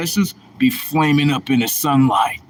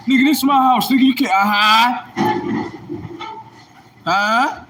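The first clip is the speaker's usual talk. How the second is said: shout; 9 semitones higher than usual. Real, not acted. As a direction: yell him out of the house. Shouting about his house.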